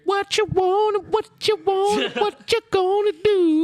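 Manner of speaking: high, slow loudspeaker wail; police siren voice. Retold or imitated. Cops on quota patrol, high slow megaphone calls. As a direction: stretch it like a cop megaphone. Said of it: "all you hear is".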